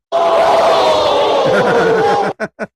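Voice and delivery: high shrieking burst of laughter. Real, not acted. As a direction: let out a piercing laugh. Outburst at soundboard trouble.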